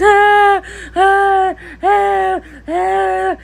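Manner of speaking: hammed-up childish wailing; imitating toddler's wails. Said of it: "she's making the noise".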